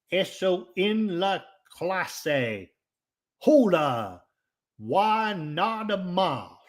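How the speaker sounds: Spanish read slowly in a thick Okie twang. Shown as a demonstration. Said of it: "I'm gonna say it in my Okie twang".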